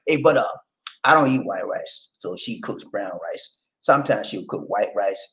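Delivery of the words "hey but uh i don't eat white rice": usual speaking voice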